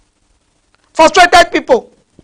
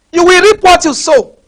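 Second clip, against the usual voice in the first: raised, higher angry warning; 5 semitones higher, 4 dB louder than usual. Real, not acted. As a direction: warn them sternly, voice lifted. Angry rebuke of wrongdoers.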